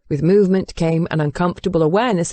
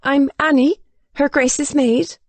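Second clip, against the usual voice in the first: put-on much higher, nervous young servant; 8 semitones higher than usual. A narrator's reading. Maid introducing herself to the Duke, high and nervous. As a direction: introduce yourself uneasily to the master. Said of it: "the maid sounded nervous".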